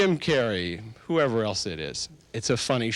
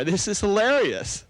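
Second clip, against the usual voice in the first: amused delight; 9 semitones higher than usual. Shown as a demonstration. Hypnotist models delight at a comedy for subjects, amused. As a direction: gush over how hilarious it is. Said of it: "So you already start to laugh".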